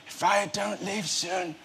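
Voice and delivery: slow, high, grandiose mock proclamation; acted anaesthetic delirium. Patient who thinks he's a knight, acted delirium. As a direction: build slowly to a high, heroic cry. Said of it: "you can hear the words tumbling out of your mouth".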